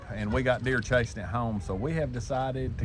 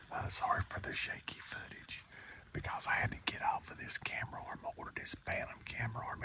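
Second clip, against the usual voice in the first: soft hushed whisper; 4 semitones lower, 14 dB quieter than usual. Real, not acted. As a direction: whisper so the deer won't hear. Whispered hunting vlog in stand.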